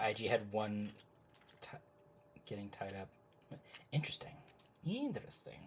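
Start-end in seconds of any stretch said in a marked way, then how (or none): 4.8-5.6 intrigued. Real, not acted. Reacting to her own chat, higher and intrigued. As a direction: lift "Interesting." up high, intrigued. Reading chat, repeats word.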